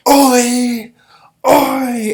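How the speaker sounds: high, loud chanted battle shout; army war cry. Retold or imitated. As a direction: shout it like a whole army roaring. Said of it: "Everybody going".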